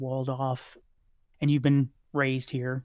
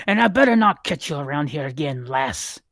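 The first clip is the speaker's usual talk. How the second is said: gruff merchant warning; 6 dB louder than usual. Acted. Merchant warns the child off.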